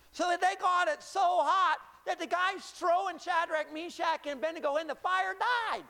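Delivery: high-energy pulpit storytelling; animated preaching. Acted. Preaches at full force.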